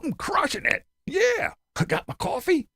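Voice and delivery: high mock-cheerful Tim. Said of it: "And Tim, you know".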